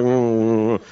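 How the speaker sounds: a low, soft, reluctant congregation mumble; low mumbled hum. Retold or imitated. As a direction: hum it low and half-hearted like a sleepy crowd. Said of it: "everybody kinda mumbled like".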